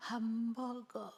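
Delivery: a slow, half-hearted no; a hungry child's feeble no; weak hungry refusal. Retold or imitated. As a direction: refuse faintly, out of hunger. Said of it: "배고프거든".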